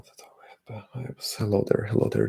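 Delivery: ordinary talk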